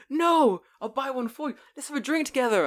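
high, insistent friendly shop owner; imitating shop owner. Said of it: "And I'm like".

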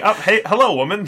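mock awkward greeting. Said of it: "They're just like".

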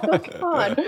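half-laughing dread at the task; dismayed oh God. Retold or imitated. Told to wear a wig.

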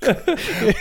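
high, real burst of laughter; can't hold in the laugh. Real, not acted. Friend's bad accent.

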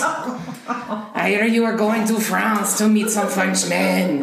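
drawn-out, hammed-up French accent; fake French accent. Shown as a demonstration. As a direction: ham it up as a Frenchman, French accent through a giggle. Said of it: "my fake French accent".

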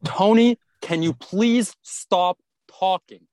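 high, drawn-out mock scolding; mock stern teacher. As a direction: ham up an exasperated teacher stopping a student. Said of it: "And she's like".

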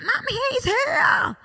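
very high, drawn-out squeal to mum; slow high-pitched kid yell; excited child shouting. Retold or imitated. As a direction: imitate a thrilled little boy's discovery. Son finds elf, shouts to mum.

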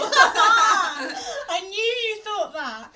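loud, high, laughing accusation; triumphant outburst. Real, not acted. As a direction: crow it, really cracking up. Triumphant at sibling's admission.

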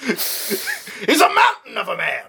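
high, slow mock awe; hammed-up astonished cry; awestruck Congress. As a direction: stretch it out in high-pitched amazement. Acts out amazed Congress.

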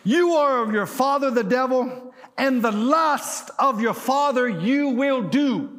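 high, loud scripture declaration; preach. Acted. Preacher quoting Jesus' rebuke to the congregation, forceful preaching. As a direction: thunder the verse out to the congregation.

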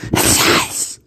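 long breathy hiss sound; creature hiss. Retold or imitated. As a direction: hiss like a lurking beast. Imitating the chupacabra's hiss.

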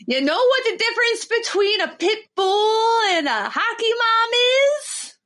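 high-pitched hammed-up folksy politician; Sarah Palin. Politician telling her hockey mom joke, high folksy impersonation. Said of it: "Sarah Palin's looking with the binoculars".

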